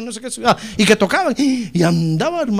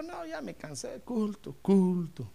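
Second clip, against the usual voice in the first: fed up; 12 dB quieter, slower than usual. Retold or imitated. Fed-up man quits church.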